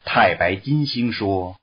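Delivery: plain reading voice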